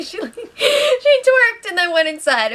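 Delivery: laughing through the words, pitch up high; can't get it out for giggling. Real, not acted. Laughing while retelling the twerk.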